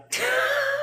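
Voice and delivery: sudden high giggle; quick squeal of amusement; quick laugh. Real, not acted. Coach pictures newcomers hearing her rant, high giggle.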